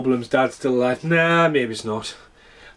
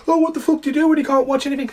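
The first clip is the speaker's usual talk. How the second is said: high, fast mock panic, much higher than his own voice; mocking panicked people; 13 semitones higher, faster than usual. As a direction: squeal it like a helpless whiner with no idea. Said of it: "Everyone's like".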